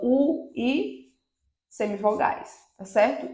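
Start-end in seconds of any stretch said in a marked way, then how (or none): none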